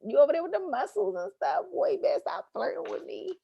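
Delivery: high playful flirt voice; hammed-up flirting with the trainer; flirty teasing banter. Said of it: "stopped getting personal trainers".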